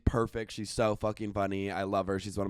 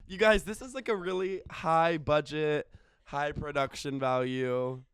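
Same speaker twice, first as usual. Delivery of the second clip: normal talking voice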